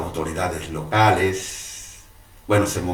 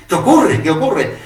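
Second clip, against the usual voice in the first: startled; 11 semitones higher, 5 dB louder than usual. Retold or imitated. Startled priest turns round.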